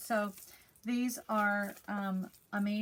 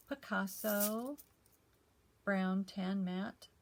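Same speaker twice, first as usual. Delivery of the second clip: careful, slow product name; slow clear naming; much slower than usual. Shown as a demonstration. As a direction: slowly spell out Picasso Brown Tan Matte, each word apart. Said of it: "this is called".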